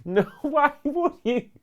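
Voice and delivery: high-pitched incredulous laughter; laughing in disbelief. Real, not acted. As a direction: laugh it out, can't believe it. Laughing at a clip.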